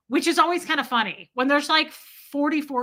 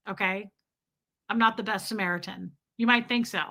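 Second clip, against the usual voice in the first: normal talking voice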